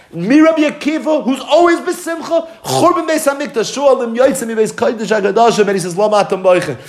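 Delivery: high, impassioned sermon voice; a rabbi carried away by his own story; fervent preaching. Acted. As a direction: preach it with rising fervour.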